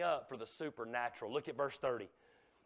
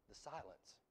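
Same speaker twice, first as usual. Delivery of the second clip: a near-silent, dropped echo; barely voiced, hanging in the air; hushed echo; 6 semitones lower, 19 dB quieter than usual. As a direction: let the word fade into silence. Hushed echo after the show.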